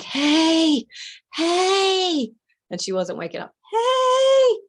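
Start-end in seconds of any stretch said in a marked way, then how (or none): 0.0-2.3 whisper yelling. Retold or imitated. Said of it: "the whisper yell". 3.7-4.6 whisper yelling. Retold or imitated. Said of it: "the whisper yell".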